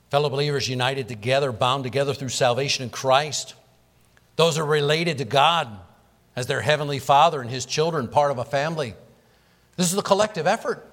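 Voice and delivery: preaching. Acted. Pastor urges the church on about missionaries, preaching fervently. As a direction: preach it out with rising fervour.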